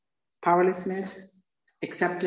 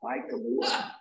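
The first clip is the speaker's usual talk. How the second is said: mock farewell; 3 semitones higher, slower than usual. Saying farewell to beer.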